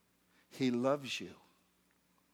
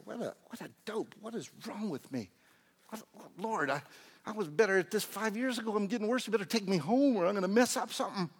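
high, fretful plea to God; worked-up, comic despair rising in urgency; exasperated self-reproach; 9 semitones higher, 4 dB louder, faster than usual. Acted. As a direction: act out a high-pitched lament at yourself. Acts out his daily self-reproach.

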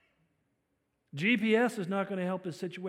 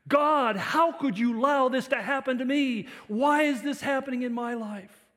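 loud, high cry of protest at God; anguished accusation; 8 semitones higher, 10 dB louder than usual. Acted. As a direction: demand answers from God, voice raised right up. Acts out betrayed person blaming God.

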